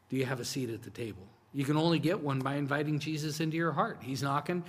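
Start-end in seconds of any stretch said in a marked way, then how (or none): 0.1-1.3 quick aside. Shown as a demonstration.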